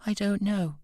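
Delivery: put-on high, small upset child voice; on the edge of tears, like a small boy; tearful little boy. A narrator's reading. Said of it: "lower lip began to tremble".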